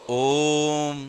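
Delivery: ओम held long and soft, vowel stretched; drawn-out Om. Shown as a demonstration. Teacher demonstrating a long vowel to the class, stretched chant. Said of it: "क्या बोलते हैं हम लोग?".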